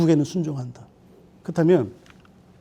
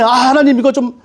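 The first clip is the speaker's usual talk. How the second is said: higher, louder mock begging; pleading to God; 6 semitones higher, 4 dB louder than usual. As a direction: beg God to cancel the ticket. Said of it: "이것 때문에".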